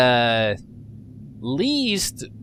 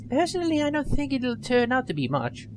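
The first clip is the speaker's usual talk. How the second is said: put-on higher, quick nerdy young woman; nerdy girl voice; 9 semitones higher than usual. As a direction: play her as an eager nerd. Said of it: "I gave her a little nerdy voice".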